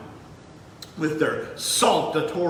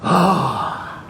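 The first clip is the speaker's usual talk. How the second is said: loud, contented "ahh" after a deep breath; satisfied exhale; 6 dB louder than usual. Shown as a demonstration. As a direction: let the big breath out with relish. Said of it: "Can you take a big, deep breath?".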